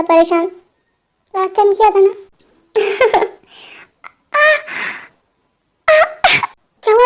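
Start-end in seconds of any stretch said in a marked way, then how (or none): 4.3-6.0 short groans of sudden pain. Acted. Sudden pain before hospital.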